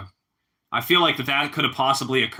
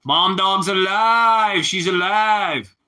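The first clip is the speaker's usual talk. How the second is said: high, loud, slow and stretched out; hammed-up cry of joy over a miracle; mock sitcom character yelling the news; excited shout; 7 semitones higher, 5 dB louder, much slower than usual. Quoting a sitcom character's outburst.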